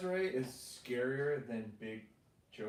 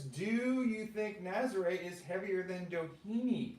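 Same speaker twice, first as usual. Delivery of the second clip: reading a question; 7 semitones higher, slower than usual. Acted. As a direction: read it out slowly and clearly. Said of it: "Amy Sabrina says".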